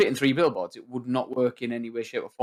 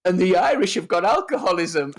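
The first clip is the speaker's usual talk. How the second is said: retold punchline; 5 semitones higher, 4 dB louder than usual. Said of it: "he's like".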